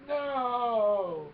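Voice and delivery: long, loud wail sliding down; mock agonized movie yell; Vader. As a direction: bellow it out high and drawn out. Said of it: "Vader's like".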